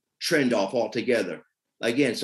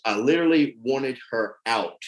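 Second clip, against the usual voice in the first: ordinary talk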